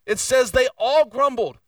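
"all" punched high and loud; correcting the congregation's assumption, emphatic; stress on ALL. Shown as a demonstration. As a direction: hammer the word all. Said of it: "It doesn't say that".

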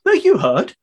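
ordinary delivery

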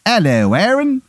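high-pitched, hammed-up English accent; Henry the eighth, bad English accent. King greets Aaron, in a bad English accent. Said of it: "it's a very bad English accent".

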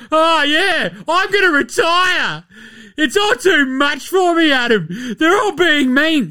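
high-pitched, hammed-up wailing; mock crybaby whine. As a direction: whine like a sulky victim. Mocking player playing the victim.

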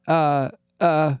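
halting, stuck for words; stammer. A narrator's reading. Narrator faces the alien, stammering. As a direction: stall on broken syllables. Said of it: "I stammered".

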